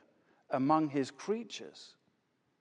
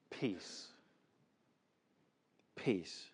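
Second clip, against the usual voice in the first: hushed; 9 semitones lower, 13 dB quieter than usual. Acted. One word named, said softly.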